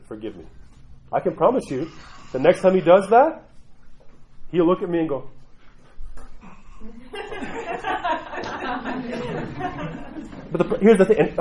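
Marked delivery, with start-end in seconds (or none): 0.1-0.4 soft, small "Forgive me"; meek apology. Retold or imitated. The boy apologising to his mother, meek and soft. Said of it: "made a prostration to my wife and said". 6.0-10.5 laugh. Real, not acted. Laughing at imagined son.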